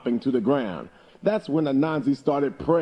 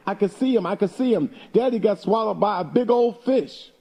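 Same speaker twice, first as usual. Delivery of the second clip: put-on high, excited child shouting; a little girl yelling with glee; excited hollering; 9 semitones higher than usual. A narrator's reading. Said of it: "started hopping up and down and hollering".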